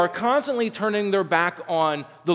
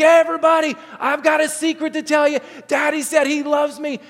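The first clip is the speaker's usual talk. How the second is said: put-on much higher and louder, a small boy announcing it; excited kid shout; 10 semitones higher, 4 dB louder than usual. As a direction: blurt it out to the whole house. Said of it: "he's not a very good secret keeper".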